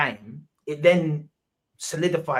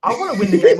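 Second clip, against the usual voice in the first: suddenly much higher and louder, indignant; heated outburst; 14 semitones higher, 9 dB louder, faster than usual. Real, not acted. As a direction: blurt it out, stung by the accusation. Heated football argument, defending himself.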